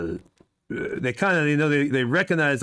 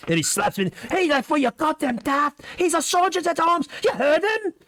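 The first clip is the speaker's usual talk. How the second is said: angry guard; 13 semitones higher than usual. Acted. DM voices guard scolding other guard.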